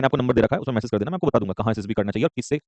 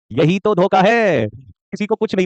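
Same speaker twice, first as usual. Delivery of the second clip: teasing; 6 semitones higher, 3 dB louder, much slower than usual. Real, not acted. Teasing students about surprise.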